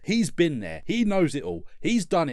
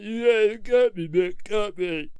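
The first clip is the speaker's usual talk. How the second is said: battered Rocky; 3 semitones higher, slower than usual. Retold or imitated. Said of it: "he's like".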